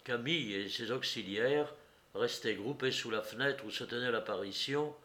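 plain reading voice

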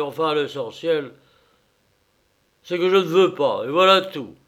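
loud drunken bully, mocking; rowdy masked bully. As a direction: boss the readers about, rude and noisy. Masked man bullies the readers. (brute masquée bruyante)

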